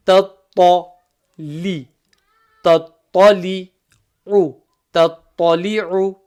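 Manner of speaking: tattali'u drawn out slowly; slow blended demo. Shown as a demonstration. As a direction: stretch tattali'u out gently. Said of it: "blending syllables demo".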